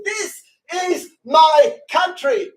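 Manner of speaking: slow, high, loud declaration; fervent cry, drawn out; impassioned shout. Real, not acted. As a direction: proclaim it word by word at full force.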